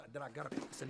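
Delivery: normal talking voice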